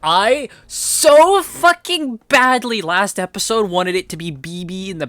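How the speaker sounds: high, loud, drawn-out fan frustration; worked-up high-pitched rant; impassioned venting. Real, not acted. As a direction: stretch it out with desperate, heated longing. Fan venting about a wished-for scene.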